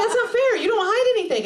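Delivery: high-pitched mock protest; protesting boyfriend. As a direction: play a wronged boyfriend, voice pushed up high. Boyfriend protesting in acted quarrel.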